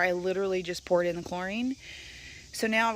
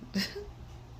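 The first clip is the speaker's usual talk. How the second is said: breathy giggle; 12 semitones higher, 8 dB quieter than usual. Real, not acted. Laughing at her own joke.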